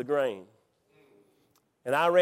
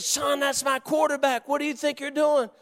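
high, strained retold scream; screaming coach; 9 semitones higher than usual. Coach confronting the player who hurt his quarterback, mock screaming. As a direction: imitate a coach hollering, pitched way up. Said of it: "he started screaming at me".

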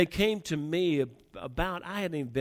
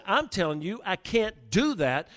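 raised, heated insistence; a little upset; 8 semitones higher, 6 dB louder than usual. Retold or imitated. New team leader refusing advice, a little upset. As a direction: retell an upset refusal, pitched higher. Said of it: "I got a little upset then".

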